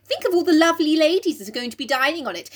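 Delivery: put-on high, recovering young lady; put-on gushing. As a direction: gush grandly in a high acted voice. Acted reply to the gentleman.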